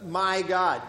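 "My God" pressed out firmly, as the point of the verse; stress on my God. Shown as a demonstration. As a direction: punch "My God" to show whose God. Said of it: "he's emphasizing "my God".

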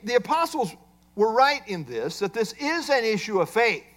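normal talking voice

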